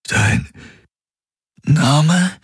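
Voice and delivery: halting, breath then high pitch; tormented. A narrator's reading. Man struggling to remember her name, tormented. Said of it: "wiederholte er gequält".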